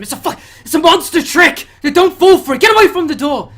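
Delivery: high, loud alarmed shouting; frantic survivor shouting; panicked warning. Acted. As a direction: yell at them not to fall for it. Frightened survivor warns the others.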